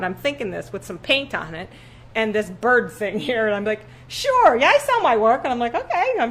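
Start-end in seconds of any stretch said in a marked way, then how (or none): 4.1-5.4 very high, loud bluff; selling herself with a squeal; eager. Retold or imitated. Retold eager reply to buyer. 5.7-6.1 very high, startled okay; bemused, high agreement; stunned by the request; bewildered okay. Retold or imitated.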